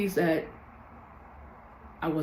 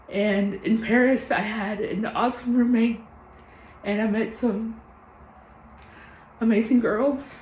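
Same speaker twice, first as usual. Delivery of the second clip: holding back tears; 3 semitones higher than usual. Real, not acted. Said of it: "I told myself I wasn't gonna cry".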